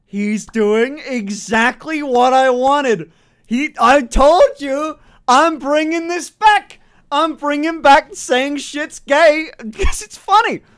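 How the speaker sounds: loud, high, drawn-out delight; gleeful exclaiming. Real, not acted. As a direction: crow it triumphantly, high and loud. Gleeful outburst at viewer's phrase.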